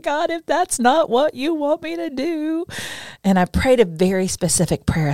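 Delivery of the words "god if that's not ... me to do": high, teary plea to God; tearful plea. Retold or imitated. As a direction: voice climbing as if near tears. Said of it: "I was crying".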